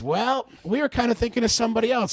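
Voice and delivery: retold awkward hedge, starting high; awkward hedging. Jeff breaks the bad news after dead air, hedging. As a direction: stretch a high "Well" into a hedge.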